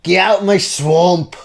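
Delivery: put-on Scottish accent. Shrek voice joke.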